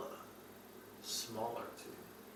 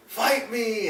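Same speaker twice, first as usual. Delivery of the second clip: high, raised mock battle cry; acted challenge; 10 semitones higher, 5 dB louder than usual. Retelling the final battle, a high mock challenge. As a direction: call out the challenge, pitched high. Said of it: "a scene when he's like".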